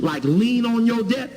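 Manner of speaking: ordinary talk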